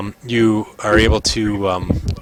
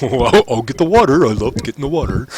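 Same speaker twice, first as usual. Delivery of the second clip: cheerful put-on character voice; volunteer gladly in an odd voice; eager helper; 4 semitones higher than usual.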